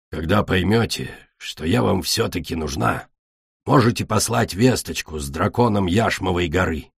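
low, subdued, disdainful farewell; a touch of grudging bitterness, kept low; lightly voiced bitter dragoness. Кречет's bitter parting words. (сдержанно и горько, низким голосом)

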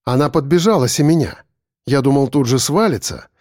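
ordinary delivery

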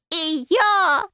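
high comic yowl; hammed-up wail. A narrator's reading. Door handle interrupting the sisters' reconciliation, wailing. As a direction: butt in with a drawn-out wail. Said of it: "завопила она".

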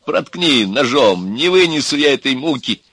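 громко, высоко, страдальчески; молить о смерти, растягивая слова; мольба. A narrator's reading. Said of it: "взмолился Иван". (отчаянно умоляюще)

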